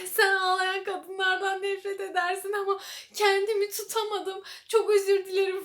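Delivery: overdone tearful whine, mocking the character; mock crying. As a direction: parody a weepy soap heroine apologizing. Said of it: "falan diye böyle ağlıyor".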